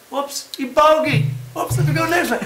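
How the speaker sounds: a carefree golfer shrugging off a miss; mock breezy golfer. Host mocking golfers who shrug off mistakes, hammed-up breezy voice.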